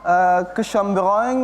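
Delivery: slow Frenchified kecombrang; French accent. Shown as a demonstration. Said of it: "dengan logat bahasa Prancis".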